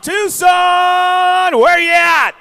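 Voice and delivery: shouted high call to the crowd; crowd callout. Real, not acted. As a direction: hype up the Tucson crowd, loud and high. Announcer calls out to crowd.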